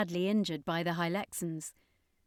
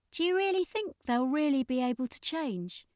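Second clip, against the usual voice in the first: Ashley; 7 semitones higher than usual. A narrator's reading. Ashley voiced.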